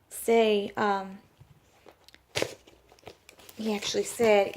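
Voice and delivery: ordinary talk, nothing marked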